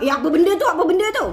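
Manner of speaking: amazed curiosity. Shown as a demonstration. Coach shows how to intrigue viewers, amazed curiosity. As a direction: wonder aloud, building excitement. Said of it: "Ha, macam tu".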